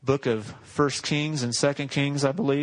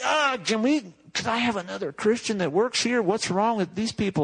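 higher, exasperated griping at work; 7 semitones higher than usual. Retold or imitated. Worker griping about coworkers, exasperated and higher. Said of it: "and you're like".

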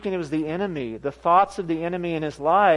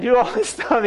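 ordinary delivery

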